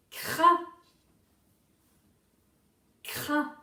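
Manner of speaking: "crin" punched out loud, with a long raspy r; dictated syllable. Shown as a demonstration. Teacher gives the next syllable to write, loud and clear. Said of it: "la prochaine syllabe, c'est".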